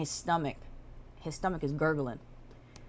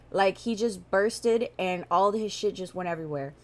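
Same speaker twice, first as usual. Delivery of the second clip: normal talking voice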